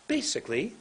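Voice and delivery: BASICALLY swooping up then down; big intonation. Shown as a demonstration. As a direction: lift BASICALLY with a pitch swing. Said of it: "How about if I go like this".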